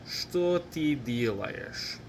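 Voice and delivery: whole question in one run, still slow and careful. Shown as a demonstration. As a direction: link the words without pauses, at learner pace. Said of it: "Now let's say it faster".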